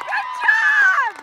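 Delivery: excited cheer. Real, not acted. Cheering after save.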